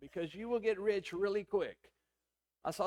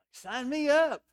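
loud, higher burst of eager enthusiasm; eager sign-up; 6 semitones higher, 9 dB louder, slower than usual. Retold or imitated. Pastor reenacting his thrill at meeting the stars' vocal doctor, louder and higher. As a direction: jump at the offer like a thrilled fan.

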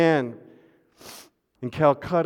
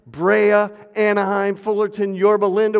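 calling out towns; 6 semitones higher, 5 dB louder than usual. Acted. Calling out town names.

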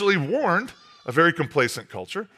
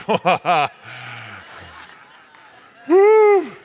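delighted laugh and whoop; 6 dB louder, slower than usual. Acted. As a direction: laugh like you just made something wonderful. God delighting over his creation.

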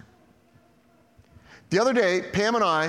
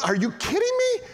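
much higher, exasperated disbelief; incredulous. Real, not acted. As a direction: cry out in disbelief.

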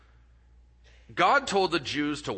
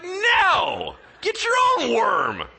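much higher, loud mock outraged no; indignant shout; 6 dB louder, much slower than usual. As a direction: yell it back in hammed-up indignation. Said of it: "You're like".

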